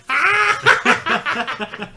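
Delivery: high laughing yelp; laughing shriek. Real, not acted. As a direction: burst out laughing. Laughing at keyboard click sounds.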